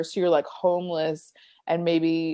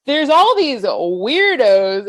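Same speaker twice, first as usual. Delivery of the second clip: mock passive-aggressive jab, slow and louder; higher, drawn out with pointed emphasis; 4 semitones higher, 6 dB louder, much slower than usual. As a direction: imitate someone making a sly dig. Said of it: "passive aggressively".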